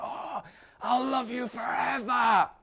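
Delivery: very high, slow swooning vow; hammed-up lovesick vow. Teacher mocking an emotional lover's promise, slow high swoon. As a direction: croon it like a besotted fool.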